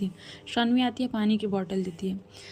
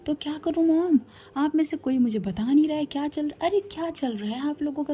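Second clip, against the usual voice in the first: angry outburst; 5 semitones higher than usual. Acted. Daughter angrily demanding answers from mom, raised voice. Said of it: "गुस्से में".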